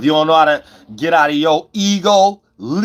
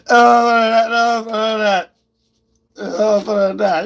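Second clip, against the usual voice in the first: drawn-out, higher "oh, for that"; slow mocking taunt; 7 semitones higher, slower than usual. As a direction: stretch it out to mock them slowly.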